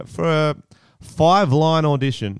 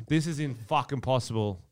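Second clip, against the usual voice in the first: ordinary talk, nothing marked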